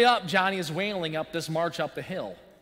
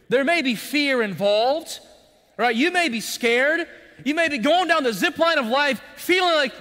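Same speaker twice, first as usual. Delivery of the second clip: fervent sermon build, much louder and higher; preaching; 8 semitones higher, 9 dB louder than usual. Acted. Preaching climax to congregation.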